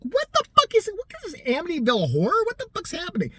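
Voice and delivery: high, alarmed retold outburst; panicked high what-the-hell; freaked-out disbelief. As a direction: sound spooked by the blood trail. Speaker's freaked-out reaction to blood.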